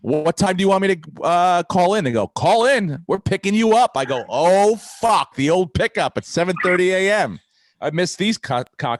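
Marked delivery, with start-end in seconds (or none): 0.0-2.0 higher, louder eager ask; eager question. Retold or imitated. Retelling asking press staff. 2.3-3.9 higher, louder mock announcement; mock bossy reply. Staff voiced mockingly. 4.3-7.4 loud, higher mock groan; mock dismay. Dismayed reaction to early pickup.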